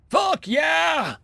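high, elated cheer; excited whoop. A narrator's reading. Kowalski hearing of war, whooping. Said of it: "Kowalski whooped".

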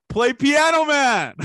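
high, slow, shouted bar request. Retold or imitated. Yelling at the jukebox guy at the bar, loud slow shout. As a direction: belt it across the bar like a movie scene. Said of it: "I'm doing the fucking Catalina Wine Mixer scene".

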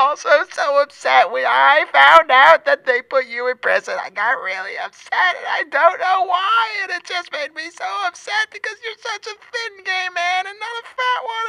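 hammed-up high tearful wail; Richard Simmons crying. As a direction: collapse from a loud cry into a small, squeaky sob. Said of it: "He goes from bombastic to crying".

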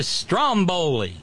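Stromboli with STROM hit hard; first-syllable stress. Shown as a demonstration. Correcting Flavio on Stromboli, stress on STROM. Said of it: "The stress is on the first syllable".

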